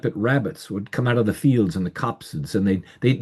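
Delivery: ordinary delivery, nothing marked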